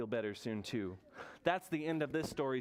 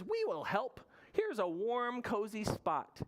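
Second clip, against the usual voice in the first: storybook Hare; 7 semitones higher than usual. Acted. Hare offers Bear help.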